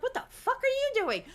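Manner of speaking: high, incredulous husband's outburst, mocked; taken-aback husband. Husband taken aback by a fight, mock shocked question. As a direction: imitate a startled husband, pitch jumping up. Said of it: "He'd be like".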